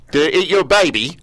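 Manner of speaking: put-on host jokes about the dingo song in a poor Australian accent; bad Australian accent. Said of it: "That was a horrible Australian accent".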